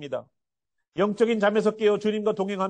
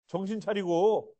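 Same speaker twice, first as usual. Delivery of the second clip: ordinary talk, nothing marked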